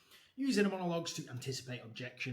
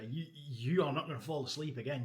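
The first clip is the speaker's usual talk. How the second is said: normal talking voice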